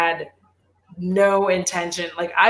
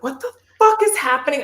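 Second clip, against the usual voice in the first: higher, startled what the fuck; startled outburst; 7 semitones higher than usual. Retold or imitated. Phone blowing up at work, a startled high outburst. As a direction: pitch up in alarmed disbelief. Retold alarm as phone blows up.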